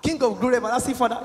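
mocking rehearsed praise. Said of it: "you have rehearsed this thing".

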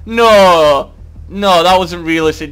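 loud, high indignant yell; outraged protest. Real, not acted. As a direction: cry foul at the game. Protesting unfair game death.

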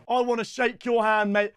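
ordinary talk, nothing marked

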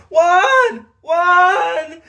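high, loud, crying scream of a name; crying yell. Retold or imitated. Mother seeing her bleeding son, crying shout. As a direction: wail his name in panic. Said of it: "The mother is right there yelling".

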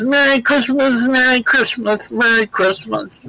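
put-on high, sing-song Italian accent. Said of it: "in that Italian accent".

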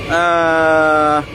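ordinary talk

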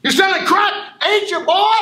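teacher losing it at a student; furious shout. Acted. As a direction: scream it at him, escalating. Repeats accusation even louder.

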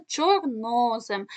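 чорнозем as чорно́зем, slow with a long middle О; stress on linking О. Shown as a demonstration. Teacher showing how two words joined, stressed middle О in чорно́зем. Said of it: "наголос якраз падає на цю літеру".

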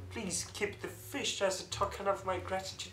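ordinary talk, nothing marked

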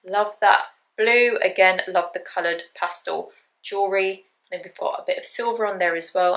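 normal talking voice

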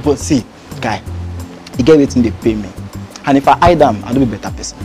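low, soft and subdued; subdued admission. Acted. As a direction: own up quietly to a friend. Confides about his modest job.

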